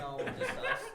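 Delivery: giggling, breathless; laughing. Real, not acted. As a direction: laugh out the words.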